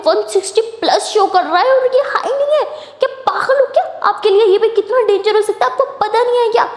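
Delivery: high-pitched, outraged scolding; alarmed yelling. A narrator's reading. Tara finds out the BP is high, alarmed high yelling. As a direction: scold in a high, panicked outburst. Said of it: "तो ऐसे चिल्लाने लग जाती है".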